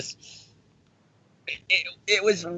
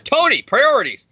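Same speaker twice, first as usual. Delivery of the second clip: scolding; 10 semitones higher, 5 dB louder than usual. Retold or imitated. Scolding Tony in retold scene.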